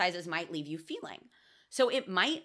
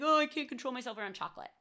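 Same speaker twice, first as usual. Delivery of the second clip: fast, higher mock-helpless whine; mocking quote; 5 semitones higher, much faster than usual. Mocking the idea that women can't resist chocolate, fast and higher. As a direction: poke fun at the cliché with a higher, quick voice. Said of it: "socially accepted to be like".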